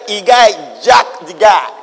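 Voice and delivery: shouted, slow "jacked the guy" grab; demonstrated shout. Shown as a demonstration. Preacher acting out the servant's attack, shouting. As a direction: bark it out like a rough attack. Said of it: "I'm demonstrating it".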